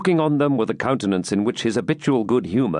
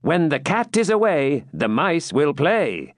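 mildly petulant; 6 semitones higher than usual. A narrator's reading. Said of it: "with some abatement of his usual petulance".

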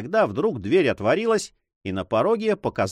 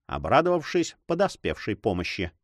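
ordinary delivery, nothing marked

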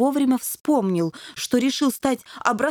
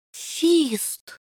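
scared but brave; 6 semitones higher than usual. A narrator's reading. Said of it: "отважно докончил перепуганный".

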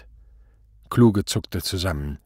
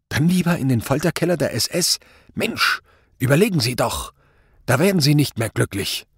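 higher, forceful, insistent warning; exasperated urging; 6 semitones higher than usual. A narrator's reading. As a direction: push him urgently to see sense. Inspector presses the terrified man. (gereizt und eindringlich)

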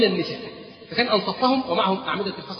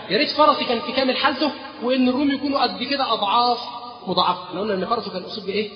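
normal talking voice

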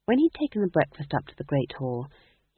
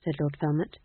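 plain reading voice

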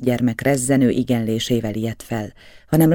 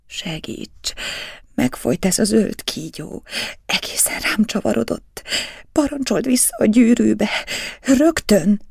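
terror building to screams; 8 semitones higher than usual. A narrator's reading. Lorenza begs Giuseppe in rising terror, whisper to scream. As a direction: gasp it out, then scream for help. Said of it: "Zihálva nehezen lélegzett".

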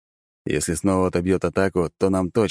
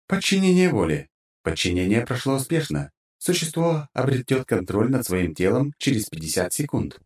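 system voice; 5 semitones higher than usual. Acted. System notification voice.

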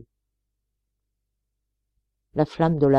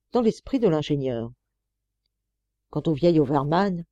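ordinary delivery, nothing marked

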